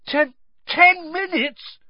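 very high, loud, drawn-out; slow high-pitched shocked outcry; alarmed disbelief. A narrator's reading. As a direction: panic as the news sinks in. Repeats the shocking news in alarm.

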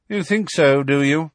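mild scornful challenge; scornfully. A narrator's reading. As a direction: throw it back with scorn. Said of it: "said Professor McGonagall scornfully".

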